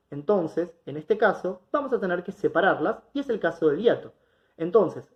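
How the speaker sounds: ordinary delivery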